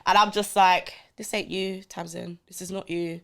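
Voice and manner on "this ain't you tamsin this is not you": quiet self-talk. Retold or imitated. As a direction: talk yourself down softly. Inner talk to herself.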